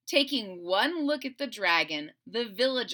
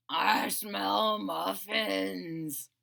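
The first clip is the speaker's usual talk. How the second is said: slow, savoring dragon mumble; slow mumbling dragon; 2 semitones lower, much slower than usual. Acted. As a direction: drag it out like a hungry dragon. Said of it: "he mumbled".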